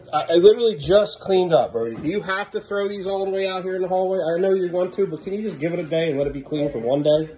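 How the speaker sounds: high, strained parental pleading; exasperated. Real, not acted. Dad pleads with Brody after just cleaning up, exasperated. Scolding Brody over fresh mess.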